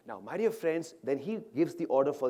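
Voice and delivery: ordinary talk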